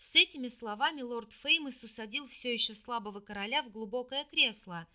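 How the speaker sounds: ordinary delivery, nothing marked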